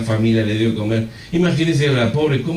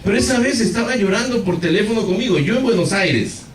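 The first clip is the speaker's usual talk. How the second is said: ordinary talk, nothing marked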